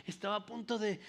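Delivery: ordinary delivery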